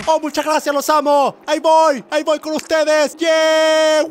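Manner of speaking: high, loud, elated cheering to fans; rock star crowd-surfing. Pretend. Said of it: "Este es como un concierto".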